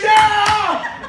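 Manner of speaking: loud high ballpark yeah; retold cheer. Son cheering at the baseball game, loud whoop. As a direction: whoop it like a kid in the stands. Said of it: "started screaming, started cheering".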